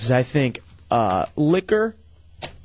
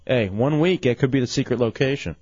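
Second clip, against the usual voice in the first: usual speaking voice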